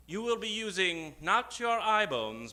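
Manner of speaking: normal talking voice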